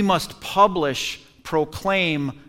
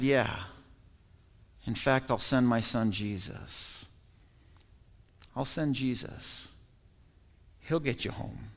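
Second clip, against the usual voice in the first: gentle voice of God; 7 semitones lower, 13 dB quieter than usual. Acted. God's reply voiced softly.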